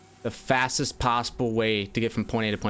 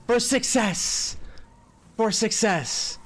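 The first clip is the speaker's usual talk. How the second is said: high, slow triumphant cry; excited cheer; 10 semitones higher, much slower than usual. Real, not acted. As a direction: stretch it out like a victory call. Cheering the final lap.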